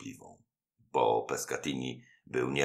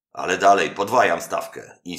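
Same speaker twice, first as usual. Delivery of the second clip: louder, higher push to go on; eager challenge; 5 semitones higher, 8 dB louder than usual. A narrator's reading. The beaten host demands another game, loud and higher. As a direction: raise the stakes boldly.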